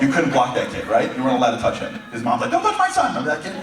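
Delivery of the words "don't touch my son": high, loud protective mom shout. Retold or imitated. Mom shielding her small son at basketball, shouting high. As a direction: snap at them like a fierce mother.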